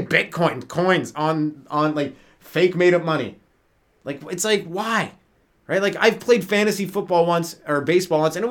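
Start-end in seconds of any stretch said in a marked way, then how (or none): none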